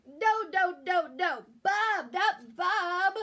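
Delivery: loud call through a blocked nose; a sick child yelling; stuffy-nosed shout. Acted. Sick boy calls out stuffily.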